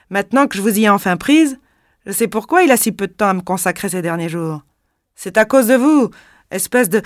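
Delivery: cri aigu et fort, qui monte; voix perçante. Acted. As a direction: hurler l'accusation sans retenue. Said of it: "cria d'une voix perçante". (la femme crie sur Irène, voix perçante)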